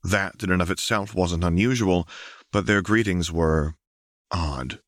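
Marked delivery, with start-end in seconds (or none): none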